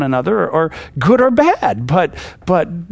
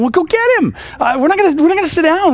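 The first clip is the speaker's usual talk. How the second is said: put-on much higher, urgent demand to fetch the boy; insistent Samuel; 11 semitones higher, faster than usual. Samuel pressing Yishi to fetch David, urgent and high. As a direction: insist firmly, pitched right up. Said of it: "Samuel has to say to him".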